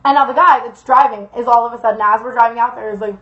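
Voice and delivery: usual speaking voice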